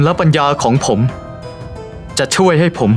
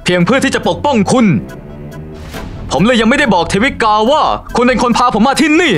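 high, urgent pleading; agitated protest; 7 semitones higher than usual. Acted. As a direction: defend yourself, voice rising. Protesting an accusation. (ตัดพ้ออย่างร้อนรน)

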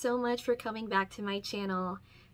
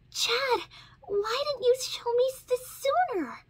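much higher, louder alarmed question; 14 semitones higher, 5 dB louder than usual. A narrator's reading. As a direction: cry out in alarm at the wound. Ladybug sees fresh wound.

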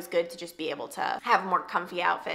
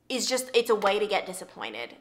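ordinary delivery

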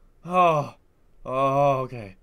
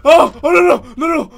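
loud, breaking pleas of fear; panicked no; 8 semitones higher, 10 dB louder than usual. Real, not acted. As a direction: beg it away in a panic. Panicking after jump scare.

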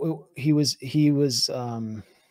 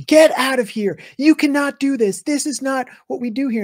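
high, loud mock scream; shrill-pitched retold outburst, mid-story; 11 semitones higher, 6 dB louder than usual. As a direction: imitate someone screaming at you, pitched way up. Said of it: "starts screaming at me".

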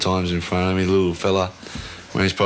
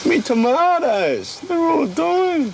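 dismayed; much slower than usual. Real, not acted. Finds dying tomatoes.

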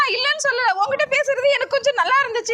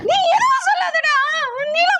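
angry shout; 6 semitones higher than usual. Acted. Quarrel, she shouts him down.

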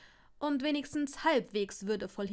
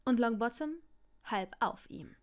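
ordinary delivery, nothing marked

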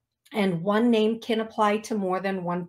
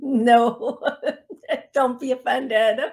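laughing; 2 semitones higher, 4 dB louder than usual. Real, not acted. Laughing apology.

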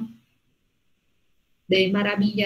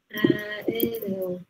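whispered; 3 semitones lower, 6 dB quieter than usual. Shown as a demonstration. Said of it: "Este adjetivo, aéreo".

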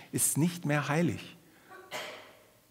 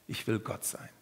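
whispered confession; 4 semitones lower, 8 dB quieter than usual. Acted. Voicing sinful human desire.

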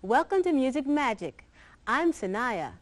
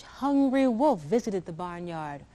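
plain reading voice

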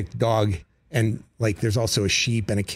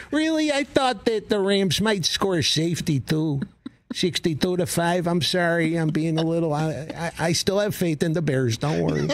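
higher, breezy Chicago superfan voice; casual Chicago superfan; 6 semitones higher than usual. Retold or imitated. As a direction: toss out an absurd prediction with mock casual confidence. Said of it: "I'm just very casually tossing out".

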